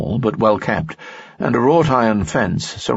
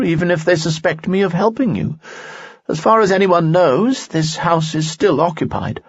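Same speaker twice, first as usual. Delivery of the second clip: higher, resolute nobleman; a loyal noble standing firm, pitched up; Lanson's voice; 10 semitones higher than usual. Acted. Lanson speaks in story.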